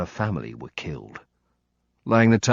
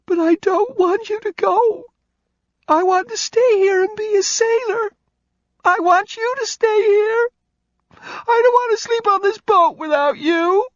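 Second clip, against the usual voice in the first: high, louder sobbing plea; sobbing child; 8 dB louder than usual. Acted. Kelly sobbing to Nick not to leave. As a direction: beg through sobs like a heartbroken kid. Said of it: "The cry had become heart-rending sobs".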